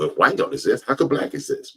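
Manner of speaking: ordinary talk, nothing marked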